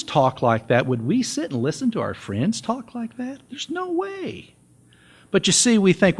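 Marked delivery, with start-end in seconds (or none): 0.9-4.5 high-pitched incredulous question fading quiet; rhetorical disbelief. Real, not acted. Preacher challenges church on tolerating movies, incredulous and high. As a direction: rise high in disbelief, then drop softly.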